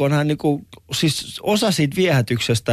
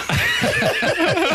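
real shrieking laugh. Real, not acted. Laughing at the friend's shout, shrieking. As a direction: lose it laughing. Laughing loudly at own story.

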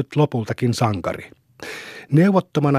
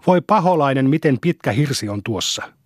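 plain reading voice

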